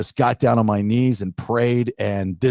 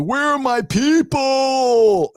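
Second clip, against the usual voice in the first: high, slow, alarmed cry; a dazed shout looking for family; panicked call; 12 semitones higher, much slower than usual. Retold or imitated. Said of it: "And I'm like".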